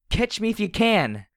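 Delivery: very high, loud gleeful yell, no real laugh; laughing taunt. A narrator's reading. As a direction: shout a playful dare while running. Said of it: "I laughed as I ran".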